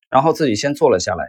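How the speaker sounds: ordinary delivery, nothing marked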